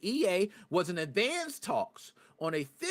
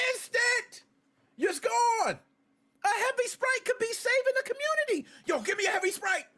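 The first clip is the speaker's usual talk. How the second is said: hyped shouting. Real, not acted. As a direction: yell it like the soda saved your life. Hyping heavy Sprite to chat.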